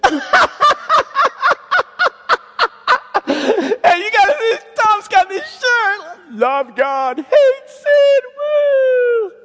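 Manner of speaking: high, loud, shrieking mockery; jeering sister. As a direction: imitate a sister hysterically ridiculing the shirt. Imitates sister jeering at shirt.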